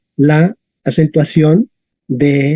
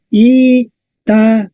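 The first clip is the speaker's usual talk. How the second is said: "Italia" slowly split into I, ta, li, a; syllable by syllable; 3 semitones higher, much slower than usual. Shown as a demonstration. Teacher counting four syllables, "Italia" said piece by piece. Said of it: "Entonces tenemos I, ta, li, a".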